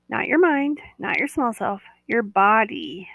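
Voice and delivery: ordinary delivery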